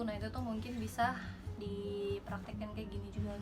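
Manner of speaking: normal talking voice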